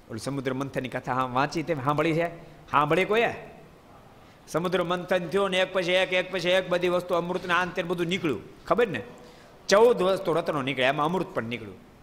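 ordinary talk

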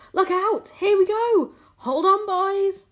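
high, loud warning cries; excited shouting. A narrator's reading. Crew as the peach rises, excited shouting. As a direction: holler it, stretching the last call.

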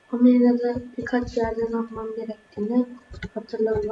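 ordinary delivery

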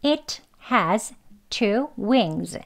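"It has two wings" read slowly and distinctly; slow careful model. Shown as a demonstration. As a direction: read it word by word first. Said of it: "as 정도로만 발음되는 경우".

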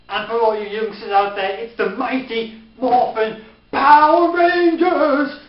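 high, loud, gushing fan cry; overexcited shout. Real, not acted. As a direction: yell it like a thrilled fan. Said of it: "A bit too excited about that".

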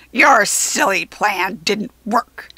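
loud, slow triumphant jeer; gloating goblin. A narrator's reading. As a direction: gloat over it, relishing each word. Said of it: "the goblin gloated triumphantly".

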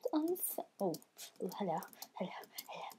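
sly quiet hello; whispering. Real, not acted. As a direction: breathe it, sneaking up. Sneaking up on an enemy.

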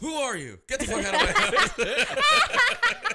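high, loud, slow outraged yell; loud confused shout. Retold or imitated. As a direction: yell at a stranger in the house. Imitating confused old man.

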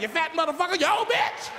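high, shrill-free yelled insults trading; mock shouting match. Old lady and comic trade insults, shouting. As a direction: yell it like a church brawl.

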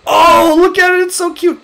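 high-pitched cute-overload squeal; delighted gushing. Real, not acted. Parent first looks at the baby dragon, delighted gushing. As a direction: gush high over something adorable.

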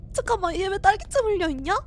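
ordinary talk

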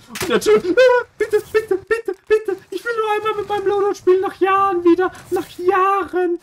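very high, loud pleading; begging squeal. Acted. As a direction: beg in a squeaky mock voice. Fleht den Gegner an. (sehr hoch und laut flehend)

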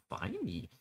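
ordinary talk

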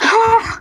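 sudden high gasp; quick startled intake of breath; sharp gasp. Acted. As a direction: gasp in shock, pitched high. Gasp while reading scene.

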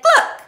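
very high, loud cluck; hen cluck. A narrator's reading. Hen hears of the plot, loud high clucking. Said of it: "the hen said".

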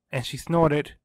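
ordinary delivery, nothing marked

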